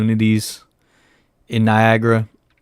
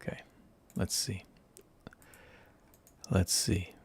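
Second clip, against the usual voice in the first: whisper; 13 dB quieter than usual. Real, not acted. Searching quietly for photo.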